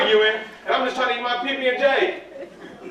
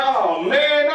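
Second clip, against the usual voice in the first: ordinary talk, nothing marked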